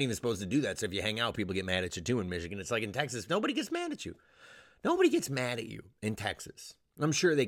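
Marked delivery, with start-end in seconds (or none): 3.3-4.2 high squeaky insistence; squeaked, incredulous assurance; comic emphasis. Acted. Squeaky first take, then repeated.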